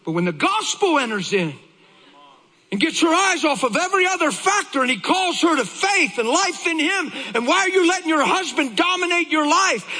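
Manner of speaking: high, full-voiced sermon shout; impassioned preaching. Real, not acted. Preacher exclaiming over his wife's revelation, fervent.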